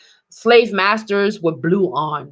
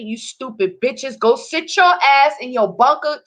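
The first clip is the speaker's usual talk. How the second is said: usual speaking voice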